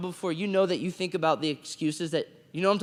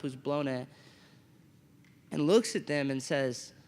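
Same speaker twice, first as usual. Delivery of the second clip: normal talking voice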